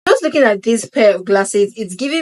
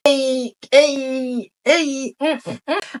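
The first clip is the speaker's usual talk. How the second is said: playful vibing; 5 semitones higher, slower than usual. Real, not acted. Vibing while trying glasses on.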